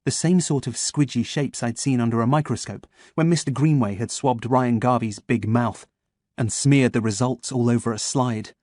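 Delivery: ordinary delivery, nothing marked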